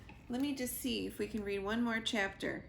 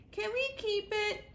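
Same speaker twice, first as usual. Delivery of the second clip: very high pleading little voice; tiny chick voice; 13 semitones higher than usual. A narrator's reading. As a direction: beg like a wide-eyed little chick. Little chick begs to keep squirrel.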